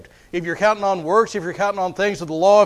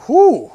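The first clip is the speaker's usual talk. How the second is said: high, loud "whew" of surprise; mock startled whew; 8 semitones higher, 9 dB louder than usual. Preacher acting someone catching their reflection in the mirror, mock startled. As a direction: let out a playful, startled whew.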